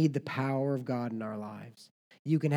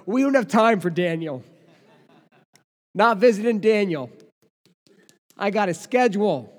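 mock impatient self; 6 semitones higher, 9 dB louder than usual. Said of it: "I was like".

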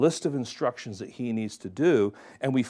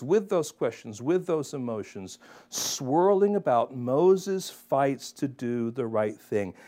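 ordinary talk, nothing marked

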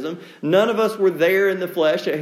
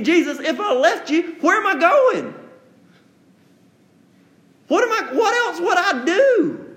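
laughing disbelief; 10 semitones higher, 3 dB louder than usual. Retold or imitated. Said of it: "The idea being".

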